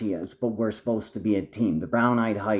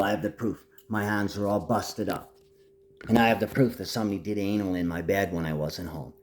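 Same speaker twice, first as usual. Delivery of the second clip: ordinary delivery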